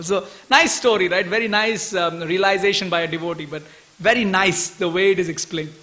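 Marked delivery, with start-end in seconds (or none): none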